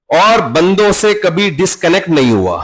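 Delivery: ordinary talk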